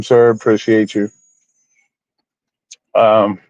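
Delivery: ordinary talk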